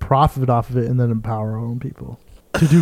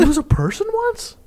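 high incredulous voice. Pretend. Reacting in puppet bit.

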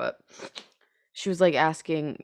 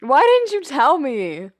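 high, loud, slow dismay; half-laughing mortified complaint; embarrassed protest; 7 semitones higher, 6 dB louder than usual. Real, not acted.

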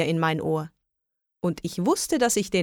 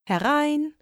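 high, friendly singled-out call; 8 semitones higher than usual. A narrator's reading. Shop lady welcomes the witch, friendly and high. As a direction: welcome someone in with a lifted voice. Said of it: "sagte eine freundliche Stimme".

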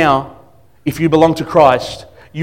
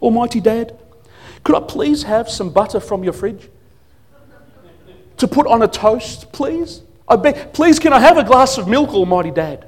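put-on grovelling child voice; 8 semitones higher than usual. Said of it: "Imagine my kids".

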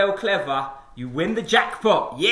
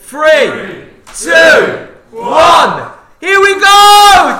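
shouted countdown; 10 semitones higher, 14 dB louder, much slower than usual. Real, not acted. Shouted countdown to a drinking race.

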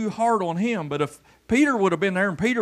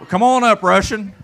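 loud call to the volunteer, higher; 4 semitones higher, 9 dB louder than usual. Real, not acted. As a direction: shout him up onto the stage. Calling volunteer up to stage.

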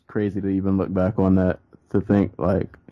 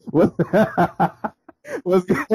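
giggling, high, barely holding it together; laughing through the words; stifled giggles; 8 semitones higher than usual. Real, not acted. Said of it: "I can't even keep a straight face".

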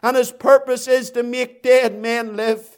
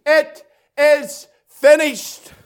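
very slow, word-by-word proclamation; triumphant cry; 4 dB louder, much slower than usual. Acted. Preacher voicing Jesus' last cry on the cross, slow proclamation. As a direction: cry it out, each word standing alone. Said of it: "He cried on that center cross".

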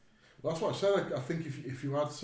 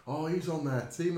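raised, higher-pitched mimicked call; retold shout; 7 semitones higher, 5 dB louder than usual. Player shouting over to his team about an enemy, retold shout. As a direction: imitate someone calling out a warning. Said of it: "and he shouted over".

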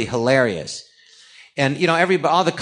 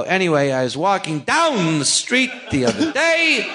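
Christopher Walken impression; 6 semitones higher than usual. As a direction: stretch out the pauses like Walken, laughing through it. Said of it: "So he'll go".

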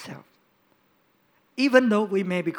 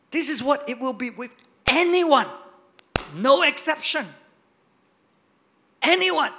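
louder, higher on anyone; louder preaching on anyone; emphatic preaching; 5 semitones higher, 5 dB louder than usual. Real, not acted. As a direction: insist there's no exception.

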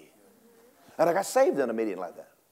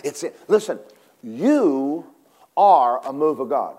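ordinary delivery

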